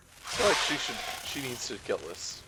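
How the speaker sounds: usual speaking voice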